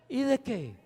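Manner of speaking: a touch of a sharp, huffy woman; the huffy little tone of an offended wife. Pretend. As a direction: toss it back, bristling. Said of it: "y el tonito, es el tonito".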